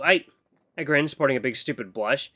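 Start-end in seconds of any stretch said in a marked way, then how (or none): none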